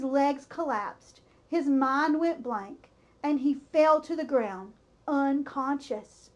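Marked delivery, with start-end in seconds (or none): none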